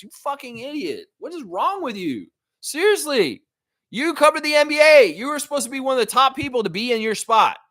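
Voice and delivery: high, heated tirade; outraged berating of the announcer; angry rant. Real, not acted. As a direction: scold him in disbelief. Ranting at Greenberg.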